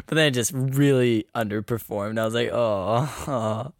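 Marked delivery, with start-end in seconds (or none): 2.5-3.7 low, soft, sinking "oh"; deflated disappointment. Retold or imitated. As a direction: let it drop quietly, let down. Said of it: "Just made me so sad to see".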